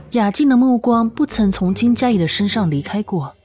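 ordinary talk

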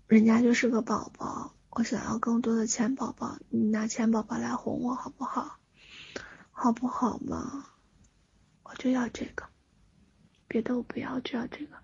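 put-on soft childish begging whisper; cutesy whiny pleading. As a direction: wheedle like a spoiled little kid. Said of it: "你只需要撒娇".

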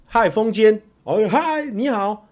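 bright, high, easy hello to a fan; casual friendly wave-hello voice; breezy greeting. Shown as a demonstration. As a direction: show how a relaxed fan says hi. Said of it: "大方的打招呼".

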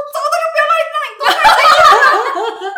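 high, slow, laughing through the words; coach's rattled order, laughed out. Retold or imitated. Coach after the near crash, laughing. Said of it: "教练就说".

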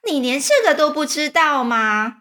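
high, loud incredulous centipede; loud exclamation. A narrator's reading. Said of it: "蜈蚣大声地说". (大声惊问)

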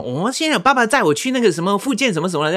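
high, chatty mom voice; mock mom on the phone. The mother telling her son who's driving her, lightly high voice. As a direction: play the mother casually explaining.

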